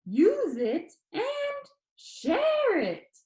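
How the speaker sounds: stretched out slowly and high; slow repeat. A narrator's reading. Danny repeats his motto, slow and emphatic. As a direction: repeat it, drawing out each word. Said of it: "he repeated".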